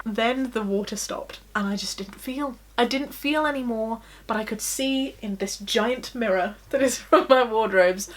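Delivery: ordinary talk, nothing marked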